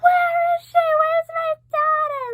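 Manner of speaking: high, loud mock-hysterical wail; screaming and crying. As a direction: act out a frantic, tearful scream. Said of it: "started fucking screaming and crying".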